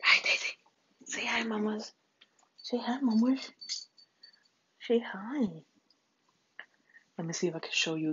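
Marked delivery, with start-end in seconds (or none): 0.0-0.5 hushed greeting to the dog; whispering. Real, not acted. 1.1-5.6 high pet-talk voice. Real, not acted. Baby talk to her dog.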